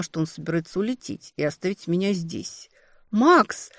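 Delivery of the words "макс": urgent breathy call, rising. Acted. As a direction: call him back before he flies off. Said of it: "выдохнула".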